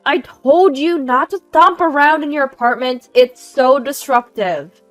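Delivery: aggressive complaint. Acted. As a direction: lay into the neighbor, very loudly. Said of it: "the aggressive complaints are nonstop".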